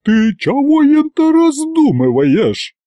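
high, drawn-out indignant cry; loud scolding wail; indignant holler. A narrator's reading. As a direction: wail it out slowly, pitched well up. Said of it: "завопила Агриппина Падловна".

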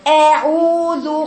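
long vowels in "أعوذ", leaning downward toward kasra; dropped-jaw wrong fatha. Shown as a demonstration. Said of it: "لأن الفك السفلي هو النزل".

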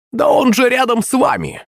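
high crone shout; shouting old witch. Acted. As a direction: cry out like a hag. Witch exclaims to the blind.

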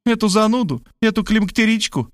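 put-on high scornful girl voice; scornful Varvara. A narrator's reading. Varvara mocking her mother to Rob, high.

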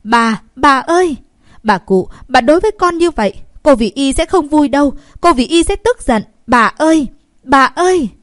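high, loud pleading cries; loud, frantic appeal to the old lady; shouting and wailing. A narrator's reading. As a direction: call out desperately as you are dragged away. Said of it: "còn lớn tiếng la hét".

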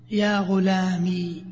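يا غلامي with the final ي held long and silent; ya ghulami. Shown as a demonstration. Teacher demonstrating the first form to students, slow and deliberate. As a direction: draw out غلامي, ending on a still long ي. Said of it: "بإثبات الياء ساكنة".

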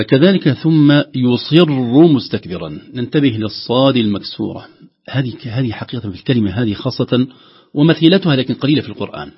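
ordinary talk, nothing marked

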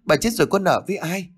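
high, distressed pleading; frantic servant pleading in a high voice; panicked cry. A narrator's reading. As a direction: cry out in panic, pitched well up. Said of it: "Con Đào liền hét lên".

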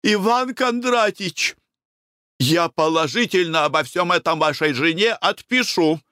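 high-pitched indignant threat; stern warning. A narrator's reading. Wife threatens to write to Konurin's spouse, stern warning.

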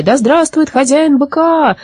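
high, slow chanted acclaim; stretched-out celebratory call; crowd cheer. A narrator's reading. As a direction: hail him like a cheering crowd. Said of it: "закричали зрители".

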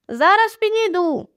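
high-pitched woman calling back; lightly acted shopkeeper's high reply; woman's voice answering. A narrator's reading. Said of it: "озвався жіночий голос".

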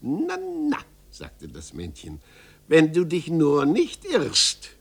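high sly tut-tut; lightly voiced hunchback, knowing; teasing little man. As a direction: warn him with mock doubt.

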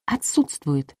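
soft, subdued single word; hushed, low-key admission; barely audible. A narrator's reading. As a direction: say it under your breath. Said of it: "еле слышно сказала я".

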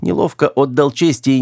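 usual speaking voice

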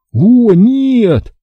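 high, drawn-out dismayed exclamation; gasped dismay. A narrator's reading. Boy finds half the dough eaten, gasping in dismay. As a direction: let out a high cry of dismay. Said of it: "выдохнул я".

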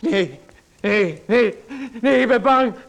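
high, loud terrified pleading; panicked terror. Acted. Writer pleads with the visitor holding scissors, in high panic. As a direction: beg in rising panic, voice climbing.

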